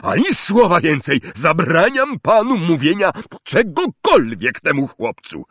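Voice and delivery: loud, high blustering voice; angry uncle. Acted. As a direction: forbid it outright. Vernon forbids.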